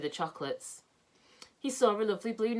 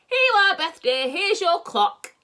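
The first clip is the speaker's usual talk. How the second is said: much higher and louder, slow and grand; proud old Saucepan; 11 semitones higher, 10 dB louder, slower than usual. Acted. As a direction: present the gift with a flourish. Saucepan presents the clock proudly.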